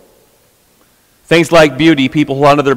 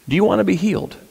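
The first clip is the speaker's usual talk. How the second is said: soft, tender question, pitched up a little; hushed and kind, a touch higher; gentle Jesus; 4 semitones higher, 7 dB quieter, faster than usual. Retold or imitated. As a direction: ask it softly, like you truly care. Jesus gently asks the paralyzed man.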